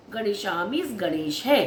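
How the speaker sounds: ordinary talk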